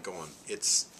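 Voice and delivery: usual speaking voice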